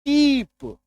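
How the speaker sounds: टीप with a high, stretched ee; long ee. Shown as a demonstration. Teacher reading out an example word, long ee in टीप. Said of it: "बड़ी ईकार की मात्रा".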